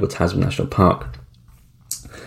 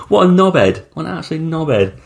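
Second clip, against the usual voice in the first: mocking disbelief; 10 semitones higher than usual. Real, not acted. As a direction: call him a knobhead, laughing in disbelief. Mocking the rugby player's line.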